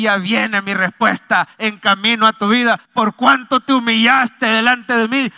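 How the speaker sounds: loud, rising prophetic proclamation; preacher building to an applause line; fervent preaching. Acted. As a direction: declare God's promise over the church. Preaches at full force.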